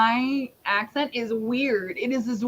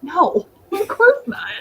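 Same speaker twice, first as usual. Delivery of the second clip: sarcastic; 9 semitones higher, 7 dB louder, slower than usual. Real, not acted. Answering was there a lake.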